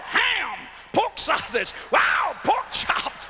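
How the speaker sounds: hammed-up high greedy delight; mock excited Gentiles. Preacher imitating greedy Gentiles, high mock excitement. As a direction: gush over the pigs in a high mock voice. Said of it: "they'd have said".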